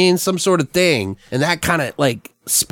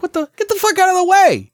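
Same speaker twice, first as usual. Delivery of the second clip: startled shout. Acted. Ambulance driver yelling at a man blocking the road, startled shout.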